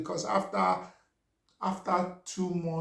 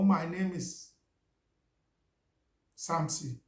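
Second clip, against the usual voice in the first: halting, long gap before the name; old strong accent. Shown as a demonstration. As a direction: imitate your halting early English, stalling before the name. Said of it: "Let me try and imitate myself".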